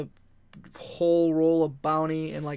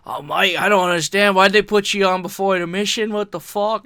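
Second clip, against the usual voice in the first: ranting; 4 semitones higher, 9 dB louder than usual. Retold or imitated. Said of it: "he's going on a rant".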